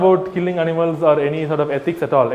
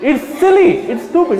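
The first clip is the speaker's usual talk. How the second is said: very high, louder laughing; laughing squeal; 9 semitones higher, 3 dB louder than usual. Real, not acted. Speaker mocks his own absurd example, laughing.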